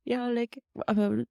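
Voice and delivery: a playful put-on French accent, slow; imitating a Belgian therapist's French lilt; fake French accent. Hosts joking about the therapist, mock French accent. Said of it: "My fr-, my fake French accent was".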